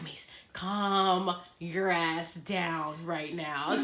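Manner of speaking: low, slow, soft self-command; drawn-out order to yourself, voice dropped; stern self-talk. Retold or imitated. Telling herself to calm down.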